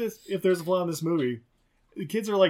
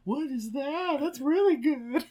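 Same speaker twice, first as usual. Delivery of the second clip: put-on high, slow wide-eyed child wonder; amazed kids; 12 semitones higher, slower than usual. Podcaster voicing the ballet kids amazed, high and slow. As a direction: gasp at the puppet like a kid.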